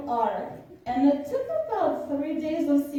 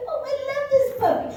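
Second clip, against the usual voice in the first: high, fast, louder gush; 5 dB louder, much faster than usual. Retold or imitated. Holding the puppy and gushing over it, high and fast. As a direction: blurt out your delight at the puppy, high and quick. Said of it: "And I said".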